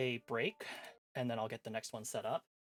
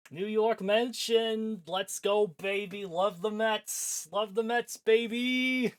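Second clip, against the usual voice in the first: higher, louder hyped fan chant, stretched out; mock sports cheer; 7 semitones higher, 6 dB louder, much slower than usual. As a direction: whoop like a Mets fan. Cheering about New York.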